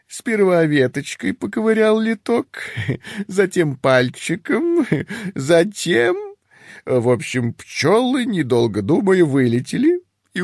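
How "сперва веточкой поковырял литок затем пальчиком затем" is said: laughing. Acted. Giggling retelling the tale.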